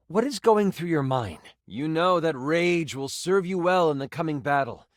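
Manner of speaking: ordinary delivery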